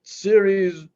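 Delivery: high, slow, loud whining; over-the-top pleading falsetto; whiny plea. Pretend. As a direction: beg like a helpless drama queen. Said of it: "you gotta be like".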